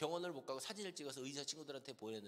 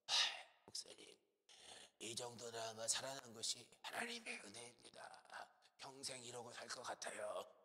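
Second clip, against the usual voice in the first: imitating a short-winded, weakened patient; low, breathy voice with laboured breaths between phrases; struggling to breathe; 3 semitones lower, 5 dB quieter than usual. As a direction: talk as if the lungs can barely manage it. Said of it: "숨을 잘 못 쉬시면서".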